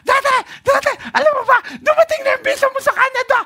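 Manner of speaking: put-on high, loud, fast little girl blurting it out; excited child shout. Five-year-old Hannah shouting the news to her daddy, high and fast. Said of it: "Itong Hanang ito".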